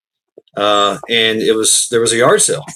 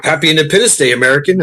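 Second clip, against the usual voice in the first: hearty holiday greeting; 5 semitones higher, 3 dB louder, faster than usual. Retold or imitated. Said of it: "is like".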